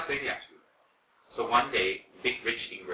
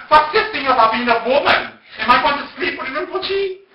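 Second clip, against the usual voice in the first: high, slow, shouted outrage; very indignant; 17 dB louder, slower than usual. Retold or imitated. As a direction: act out her loud, offended challenge. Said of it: "very indignant".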